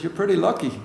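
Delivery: poor attempt at Northern Irish, lifted pitch; bad Northern Irish accent. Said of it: "in my worst Northern Irish accent".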